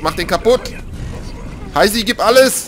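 high loud rallying shout; shouted cheer. Real, not acted. Player yelling at Heisi, loud high shout. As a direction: yell at your character to give everything.

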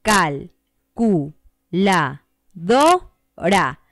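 calculadora beaten out as Cal, cu, la, do, ra; syllable count. Shown as a demonstration. Teacher counting syllables of calculadora aloud. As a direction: tap Cal, cu, la, do, ra, one beat each. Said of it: "Otra vez".

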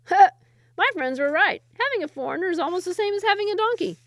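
high, loud, fast gloating; gloating miller. Acted. The mill owner gloats to himself, high and loud. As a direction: crow over your new donkey.

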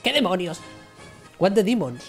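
usual speaking voice

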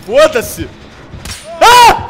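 sudden panicked scream; yelling in terror while fleeing; panicking gamer. Real, not acted. Chased in game, screaming.

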